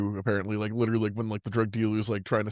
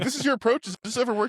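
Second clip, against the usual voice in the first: incredulous high voice; 13 semitones higher, faster than usual. Retold or imitated. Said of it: "She's like".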